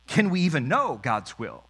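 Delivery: usual speaking voice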